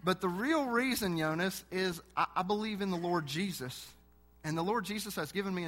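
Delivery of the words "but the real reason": ordinary talk, nothing marked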